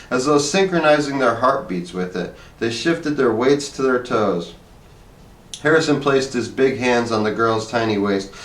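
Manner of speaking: ordinary delivery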